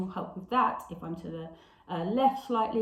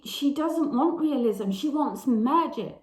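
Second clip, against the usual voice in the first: higher, louder stress on "realism" and "magic"; emphatic insistence; 5 semitones higher, 5 dB louder than usual. Real, not acted. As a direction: drive home "magic" with force. Emphasising what the character wants.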